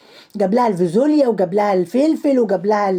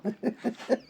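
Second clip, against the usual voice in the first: short laugh; 14 dB quieter than usual. Real, not acted. Laugh after insult.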